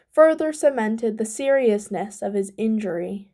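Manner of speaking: usual speaking voice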